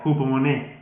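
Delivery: hupomone with stress on the E. Shown as a demonstration. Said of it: "tilde en la E". (hupomone con acento en la E)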